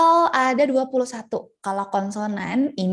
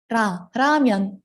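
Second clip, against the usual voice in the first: "ramyeon" said very slowly and clearly; slow clear demo; much slower than usual. Shown as a demonstration. Teacher saying "ramyeon" slowly for the class. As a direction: spell it out slowly. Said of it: "dibacanya ra".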